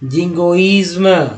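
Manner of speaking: जिंगोइज्म with a clear "izm" ending; slow model word. Shown as a demonstration. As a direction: say जिंगोइज्म slowly for learners. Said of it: "आईएसएम को इज्म बोलते हैं".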